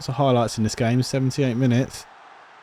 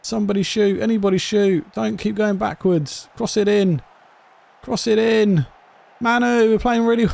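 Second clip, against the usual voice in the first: yelling at players; 10 semitones higher than usual. Real, not acted.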